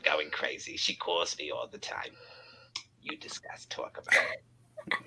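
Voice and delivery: hushed aside; whisper. Real, not acted.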